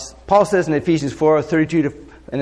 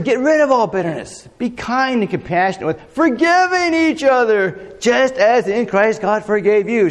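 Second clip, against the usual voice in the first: emphatic scripture reading; 6 semitones higher than usual. Acted. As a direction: proclaim the verse with rising weight.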